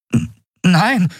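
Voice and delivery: high, stuttered denial; stammering. A narrator's reading. Endriel to the admiral on shocking news, stammering. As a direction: stumble on the first sound in disbelief. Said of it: "stotterte sie".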